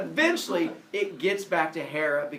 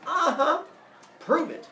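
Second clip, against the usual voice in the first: high, loud disbelieving uh-huh; sarcastic uh-huh; 12 semitones higher, 9 dB louder, slower than usual. Acted. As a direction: roll your eyes through it. Semele doubts Zeus, laughing.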